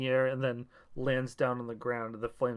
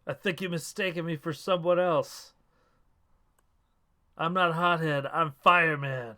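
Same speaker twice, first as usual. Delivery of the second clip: hammed-up promo reply; 4 semitones higher, 5 dB louder than usual. Acted promo reply to rival.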